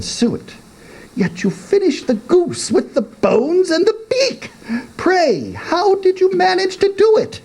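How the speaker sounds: high questioning youth voice; young man. A narrator's reading. Young man questioning his father, high voice. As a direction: needle the old man in a high voice.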